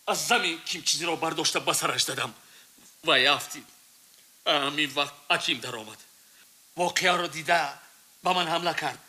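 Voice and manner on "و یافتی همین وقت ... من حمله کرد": ordinary talk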